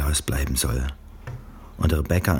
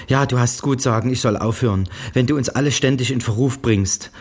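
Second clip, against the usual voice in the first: higher, louder, brusque and detached mother; curt mother on the phone; 8 semitones higher, 4 dB louder than usual. A narrator's reading. Mother scolding her daughter by phone, curt and raised. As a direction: bark it down the line like a cold mother. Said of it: "sagt Misses Springer".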